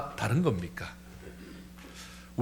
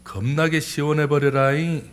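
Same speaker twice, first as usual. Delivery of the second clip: emphatic Jeolla accent; Jeolla dialect; 2 semitones higher, 4 dB louder than usual. Shown as a demonstration. Preacher showing Jeolla for feeling refreshed, Jeolla accent. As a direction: say it like a Jeolla local. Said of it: "전라도는요".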